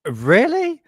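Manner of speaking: a real jolt of disbelief; surprised. Real, not acted. Streamer reacting to the Area 51 news, surprised.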